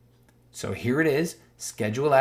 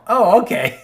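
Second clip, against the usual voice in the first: mock triumphant gloat; 9 semitones higher, 11 dB louder than usual. Closing the mock argument with a triumphant squeal. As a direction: crow over the win.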